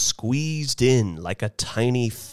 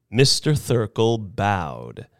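plain reading voice

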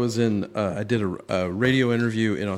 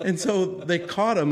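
normal talking voice

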